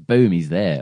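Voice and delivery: ordinary talk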